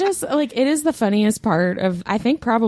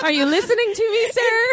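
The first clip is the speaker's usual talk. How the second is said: high, fast frantic plea; high pleading voice. Retold or imitated. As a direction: beg the man to listen, high and breathless. Imitating the fleeing woman.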